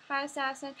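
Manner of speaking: ordinary talk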